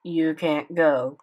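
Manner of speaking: slow, cold and flat refusal. A narrator's reading. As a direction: shut him down coldly. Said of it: "he answered coldly".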